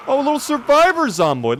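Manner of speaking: high, cute cooing voice. Acted. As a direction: coo like at a puppy. Cooing at tiny zombie.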